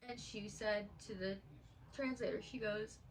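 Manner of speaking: ordinary talk, nothing marked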